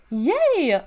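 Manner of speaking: high happy yay; excited cheer. A narrator's reading. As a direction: cheer like a little kid. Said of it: "R B clapped".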